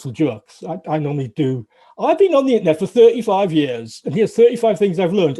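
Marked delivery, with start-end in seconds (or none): 2.0-5.4 big, louder showman's announcement of the talk title; higher and louder grand presenter voice; mock announcement. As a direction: sell it like a conference headline. Announcing his comedy talk title.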